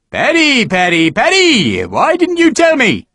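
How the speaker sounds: high, loud, slow sing-song taunt; mocking sergeant. Sergeant teasing Harper over his past, high slow taunt. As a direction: draw it out to needle him.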